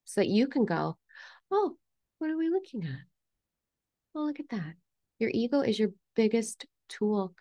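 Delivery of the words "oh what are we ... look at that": high, soft wondering; gently intrigued, pitched well up; curious self-talk. Acted. Coach modelling how to notice the ego, high gentle curiosity.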